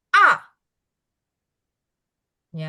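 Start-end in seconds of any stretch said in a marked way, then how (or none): 0.1-0.3 A dropping sharply; A as a sharp falling tone, startled; tone four. Shown as a demonstration. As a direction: snap A down hard like a jolt. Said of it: "nada empat turun tajam".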